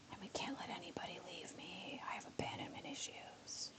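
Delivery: whispered joking confession. Pretend. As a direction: breathe it out like a secret.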